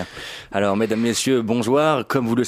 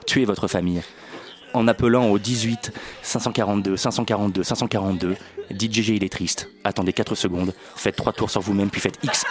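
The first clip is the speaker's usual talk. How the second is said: lower, steady solemn announcement read; mock public service ad; 4 semitones lower than usual. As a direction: deliver a spoof PSA with a straight face.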